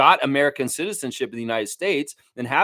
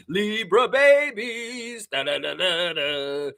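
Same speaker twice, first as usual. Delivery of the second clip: sing-song hype; 4 semitones higher, much slower than usual. Real, not acted.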